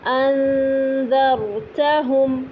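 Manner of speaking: انتم with long hidden nasal noon; very slow Quranic انتم, noon hummed near ta; ikhfa recitation. Shown as a demonstration. Teacher reciting ikhfa example slowly. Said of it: "زبان باہر تالو سے نکال لیں گے".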